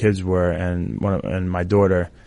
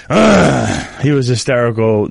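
tearful; 3 semitones higher, 5 dB louder, slower than usual. Real, not acted. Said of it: "and I still cried".

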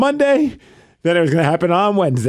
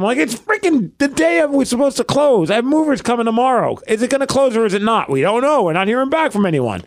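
higher-pitched, worked-up venting; exasperated rant; 7 semitones higher than usual. Retold or imitated. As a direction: pile up the grievances, voice raised in pitch. Reliving frantic wait for closing.

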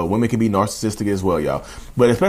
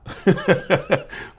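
breaking into a high giggle; high laugh; 6 semitones higher than usual. Real, not acted. Vlogger laughing at own mock advice, high giggle. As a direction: laugh at your own joke.

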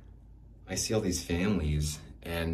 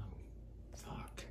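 breathless whispered oath, nearly silent; stunned whisper; 4 semitones higher, 16 dB quieter than usual. Acted. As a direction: let it slip out under your breath. Stunned reaction after imagined kiss.

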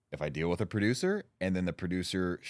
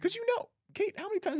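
much higher, rising, teasing; squeaky insistence. Real, not acted. Teasing Kate that she knows, a high playful rise. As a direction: push the point playfully.

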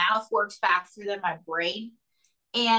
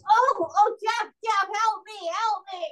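high mock pleading; 9 semitones higher than usual. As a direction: wail for help in a high voice. The Rougarou cries for help.

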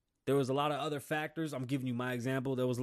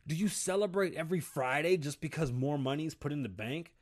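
usual speaking voice

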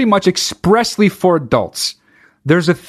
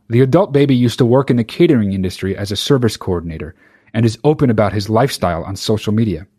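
ordinary talk, nothing marked

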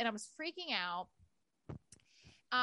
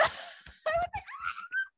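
high giggle. Real, not acted. Notification arrived.

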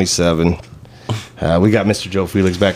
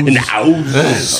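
put-on slow drawn-out Austrian accent; Arnold Schwarzenegger accent; 5 semitones higher, much slower than usual. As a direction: stretch it out in a thick Austrian accent. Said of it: "the whole Arnold Schwarzenegger".

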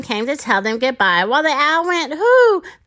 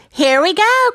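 higher-pitched storybook call; crying out; 6 semitones higher, 5 dB louder than usual. A narrator's reading. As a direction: call out to start an adventure. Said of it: "cried the hat".

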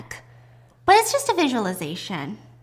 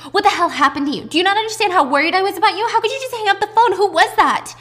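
high, loud, fast scolding from worry; upset outburst; 9 semitones higher, 6 dB louder, faster than usual. Retold or imitated. Worried daughter confronting her mother at the door, loud and upset. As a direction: fire off anguished questions, rising in pitch.

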